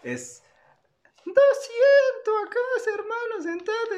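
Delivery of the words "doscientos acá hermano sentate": high, slow put-on gamer voice; excited Free Fire streamer cry; gamer streamer shout. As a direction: yell it like a hyped streamer calling a headshot. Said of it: "es la de".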